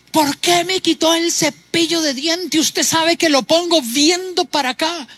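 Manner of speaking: petty complaint. Retold or imitated. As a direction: act out someone fuming over a moved toothbrush.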